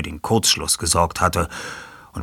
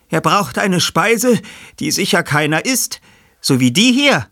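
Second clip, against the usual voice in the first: Peter, triumphierend erklärend; 13 semitones higher, 6 dB louder than usual. Acted. Peter zeigt auf das Ferkel.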